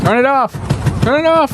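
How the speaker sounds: high, loud shout; alarmed yell. Real, not acted. Viewer calling out at a flailing robot, high alarmed yell.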